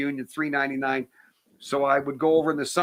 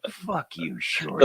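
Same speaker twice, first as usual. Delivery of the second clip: Clint Eastwood; slower than usual. Retold or imitated. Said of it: "Here he is cursing me again".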